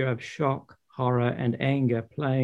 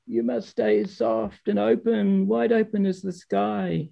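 much higher, dreamy sage voice; Father Frost; 7 semitones higher than usual. Acted. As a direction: open up like the sky. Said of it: "he continued".

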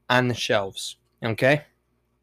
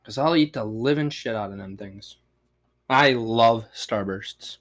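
ordinary talk

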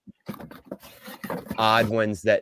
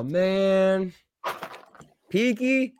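excited; 10 semitones higher, much slower than usual. Real, not acted. Fan reacting to toys.

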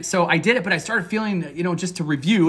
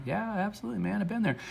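soft, higher-pitched voice of agreement; agreeing listener; 5 semitones higher, 10 dB quieter, faster than usual. Pretend. Listener agreeing with the coach, quiet aside of agreement. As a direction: murmur it like someone nodding along.

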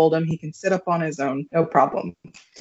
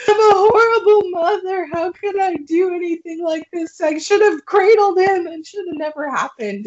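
much higher and louder, hammed-up despair; mock distraught wail; 11 semitones higher, 4 dB louder than usual. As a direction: wail it like a guilt-stricken new mom, half laughing at yourself. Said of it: "And I'm like".

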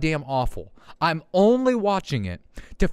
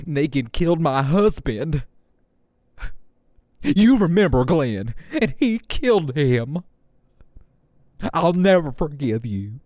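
hammed-up American accent, mock anguish; overdone American accent. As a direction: melodramatic fake American accent. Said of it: "that's not even a good accent".

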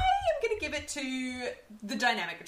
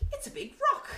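high, laughing fake British accent; a laughing stab at a British accent; Spike's British accent; faster than usual. Quoting Spike to friends, high laughing fake British accent. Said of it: "when I do his accent".

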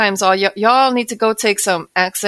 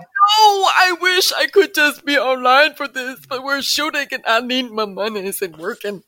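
mock wail; 5 semitones higher than usual. Podcaster retelling missing online Comic-Con while shooting, mock wailing. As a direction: wail it in regret, then fade away.

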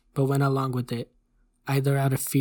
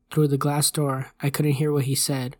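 plain reading voice

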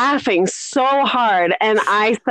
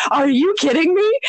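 higher, incredulous retold outburst; exasperated disbelief; 7 semitones higher than usual. Babysitter cleaning the bathroom on Saturday night, exasperated. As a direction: protest your spoiled night in mock despair.

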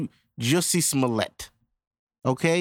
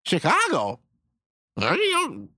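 ordinary talk

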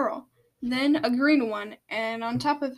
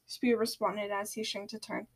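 ordinary delivery, nothing marked

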